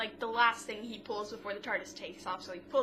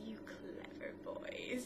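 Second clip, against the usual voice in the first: soft whisper; 12 dB quieter, slower than usual. Retold or imitated. Rose voiced in retelling.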